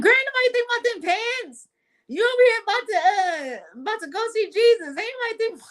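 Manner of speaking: very high, hammed-up frantic aunt; exasperated scolding. Aunt scolding her for wanting pants mid-emergency, high and exasperated. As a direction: rant in a high pitch, imitating an exasperated aunt.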